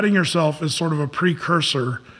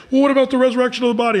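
quick, higher-pitched objection; mock protest; 7 semitones higher, 4 dB louder, much faster than usual. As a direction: fire it off as a worried objector would. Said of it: "I hear Christians protest and say".

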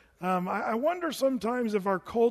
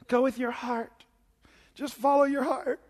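mock sentimental; 5 semitones higher than usual. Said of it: "say it with a quiver in your voice".

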